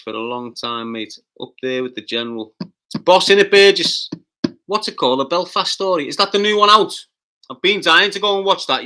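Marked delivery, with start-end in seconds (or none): 2.6-8.8 louder, higher, eager; excited praise. Real, not acted. Streamer gushing to a viewer about a new film, loud and eager.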